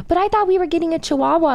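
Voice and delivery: put-on high, pleading kid voice; whiny little girl. Daughter protesting to mom about the dog, in a high mock-whiny child voice. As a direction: whine it like a disappointed child. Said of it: "Bunny was like".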